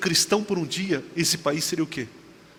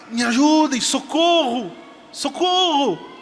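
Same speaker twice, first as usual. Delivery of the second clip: high, raised-voice plea for rescue; retold cry for help; 9 semitones higher, 5 dB louder, slower than usual. Woman trapped by the dark river, retold cry for help. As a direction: call out for help, acting out the dream. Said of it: "eu comecei a gritar".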